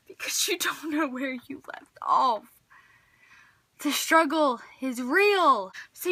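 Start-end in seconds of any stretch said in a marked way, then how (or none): 0.1-2.5 breathy whisper; whispered aside. Acted. Vlogger to viewers, whispering a dramatic aside. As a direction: hush it like a secret.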